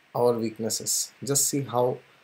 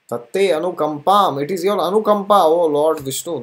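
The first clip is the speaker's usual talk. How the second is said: fervent invocation; 3 semitones higher, 6 dB louder than usual. Retold or imitated. Invoking Lord Vishnu in prayer.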